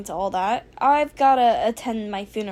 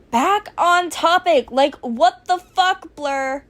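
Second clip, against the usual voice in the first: loud, exasperated outburst; exasperated shout; 5 semitones higher, 4 dB louder, slower than usual. Acted. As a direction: yell it in frustration.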